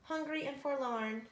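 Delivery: ordinary delivery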